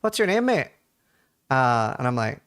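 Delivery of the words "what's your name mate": higher-pitched mock cabbie; imitated taxi driver question; retold taxi driver. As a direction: play the cabbie quizzing a passenger. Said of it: "He's like".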